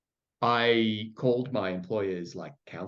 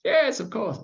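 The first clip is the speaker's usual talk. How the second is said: high, louder burst of mock eagerness; eager yes; 14 semitones higher, 6 dB louder than usual. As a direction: jump in with an over-keen agreement. Acts out the answer he wished.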